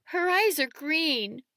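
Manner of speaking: high, drawn-out tearful wail; acted sob, pitched high and slow; sobbing child. A narrator's reading. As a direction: whimper it slowly through tears. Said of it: "Malcolm says with a sob".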